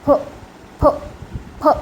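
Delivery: फ said with lips, between p and f; P sound mixed with F. Shown as a demonstration. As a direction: blend प and फ into one sound. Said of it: "प और फ ये दोनों की साउंड मिक्स करके बोली जाती है".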